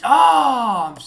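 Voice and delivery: sudden loud high cry of pain; startled yell. Real, not acted. Gamer hit by a flying projectile, loud startled yell. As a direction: yelp as the projectile hits.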